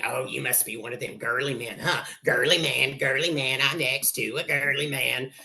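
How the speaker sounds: lightly voiced mocking bully; sing-song teasing of "girly man"; taunting. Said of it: "So he starts taunting me".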